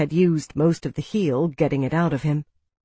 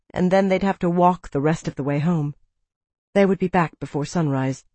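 plain reading voice

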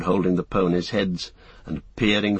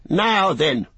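stern farmer; 8 semitones higher, 4 dB louder than usual. Acted. Farmer confronts rider.

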